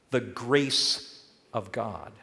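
slow, weighty words; slow and solemn. Acted. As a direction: let each word land slowly. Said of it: "slow emphatic list".

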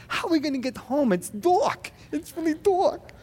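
put-on high, small toddler voice, a little hurried; scared little girl voice. Father voicing his toddler daughter lost in the dark, high and small. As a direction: imitate his two-year-old daughter, a bit worried. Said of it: "she goes".

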